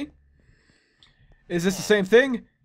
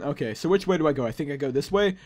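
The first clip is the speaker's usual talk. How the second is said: normal talking voice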